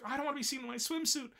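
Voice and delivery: high-pitched embarrassed whine; flustered girl. Retold or imitated. Girls explaining to the teacher, high embarrassed whine. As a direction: whine it like a shy schoolgirl. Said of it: "they're like".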